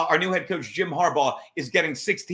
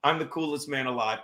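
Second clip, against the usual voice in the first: ordinary delivery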